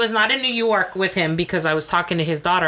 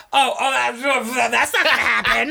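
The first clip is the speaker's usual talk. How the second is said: laughing ex; 5 semitones higher, slower than usual. Retold or imitated. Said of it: "ex answers the judge".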